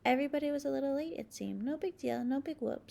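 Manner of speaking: plain reading voice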